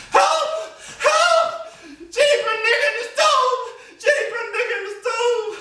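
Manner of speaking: hammed-up cry for help; panicked screaming mistress.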